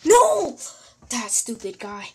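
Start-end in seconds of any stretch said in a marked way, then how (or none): 0.0-0.6 loud, high cry of protest; frustrated shout. Real, not acted. Player reacting to a setback in the game, frustrated shout.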